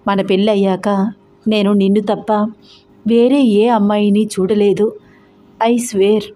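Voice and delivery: ordinary delivery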